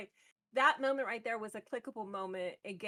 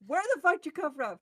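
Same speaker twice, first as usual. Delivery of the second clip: higher, louder mock shock; retold startled outburst; 5 semitones higher, 8 dB louder than usual. Caught boyfriend reacts as she pulls up, retold startled outburst. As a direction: imitate someone caught out, loud and high.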